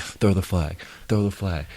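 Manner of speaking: lightly muted self-talk; saying it to himself. Retold or imitated. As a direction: repeat it low-key, just for yourself. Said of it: "I'm saying it to myself".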